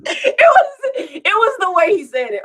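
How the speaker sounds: fighting off the giggles; still laughing. Real, not acted. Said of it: "Why did I giggle?".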